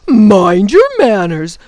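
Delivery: loud, slow jeering mimicry; mocking gaboon. A narrator's reading. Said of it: "rolled on to the ground in delight".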